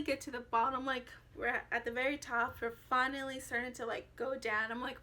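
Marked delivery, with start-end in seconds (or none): none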